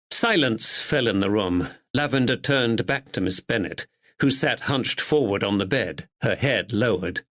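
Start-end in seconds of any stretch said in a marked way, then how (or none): none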